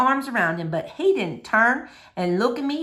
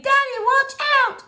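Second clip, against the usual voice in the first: put-on high, loud girl's scream of warning; 4 dB louder than usual. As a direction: yell to warn Daddy, higher and louder. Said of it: "and I screamed".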